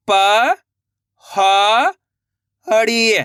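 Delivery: "प", "ह", "अड़ी", held and set apart by pauses; letter by letter. Shown as a demonstration. Teacher models the method, separate drawn-out "प", "ह", "अड़ी". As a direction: sound out "प", "ह", "अड़ी" piece by piece. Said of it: "एक एक अक्षर का उच्चारण करके".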